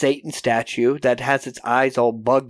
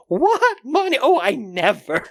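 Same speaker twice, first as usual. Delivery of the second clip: high, indignant gasp; prim shock; 9 semitones higher than usual. Retold or imitated. Prim teacher shocked that the bum wants money, high indignation. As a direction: clutch the pearls, way up high. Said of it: "Miss Johnson's like".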